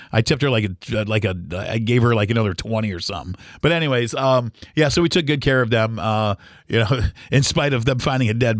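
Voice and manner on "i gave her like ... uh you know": ordinary talk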